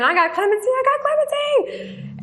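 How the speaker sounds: high, stretched-out cries of joy; joyful screaming. Retold or imitated. Inmate running through the room screaming her news, joyful high cry. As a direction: shout it like you can't believe your luck. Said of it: "and was just screaming".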